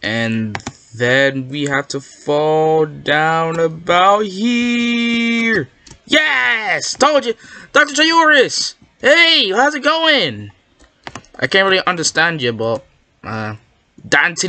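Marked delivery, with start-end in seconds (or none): 0.0-5.7 very slow, stretched words climbing in pitch; slow-motion narration of a drop; suspenseful drawn-out fall. Real, not acted. Narrating a long careful fall. 6.1-10.5 high, gleeful cheer; excited high-pitched hello; excited triumph. Real, not acted. Guess proved right, greets character.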